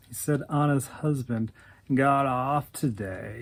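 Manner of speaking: ordinary delivery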